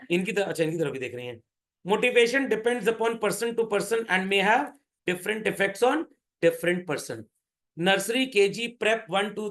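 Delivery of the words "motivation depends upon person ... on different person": each word spaced out for note-taking; slow dictation. Shown as a demonstration. Teacher to students, dictating slowly. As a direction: read it out slowly so they can write.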